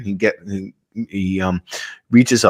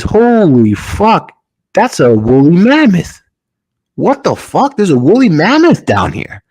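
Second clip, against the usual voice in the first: high, swooping amazed exclamations; acted astonishment; 9 semitones higher, 4 dB louder than usual. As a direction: play the pilot stunned by an impossible sight. Pilot discovers a woolly mammoth.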